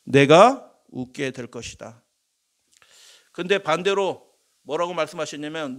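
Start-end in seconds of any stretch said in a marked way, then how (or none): 0.0-2.0 slow, loud ringing proclamation of blessing; preacher declaims Jesus' blessing to the hungry, slow and loud; Jesus proclaiming. Acted. Preacher voicing Jesus to the congregation, slow ringing proclamation. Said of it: "하나님은 뭐라고 말씀하셨어요".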